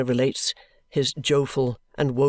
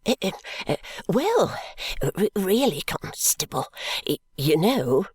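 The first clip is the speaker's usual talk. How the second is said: higher, hesitant, stammering little man; wistful stammer; 5 semitones higher than usual. A narrator's reading. Timid stationer pleading with the constable, wistful stammer. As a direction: stammer timidly, lost for words. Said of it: "says Mister Snagsby wistfully".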